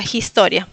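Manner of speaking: "historia" stressed on TO, h like j; second-syllable stress. Shown as a demonstration. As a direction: say "historia" as jis-TÓ-ria, falling. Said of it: "segunda sílaba". ("historia" con acento en "to")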